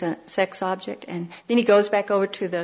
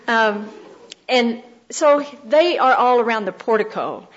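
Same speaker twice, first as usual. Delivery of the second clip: ordinary talk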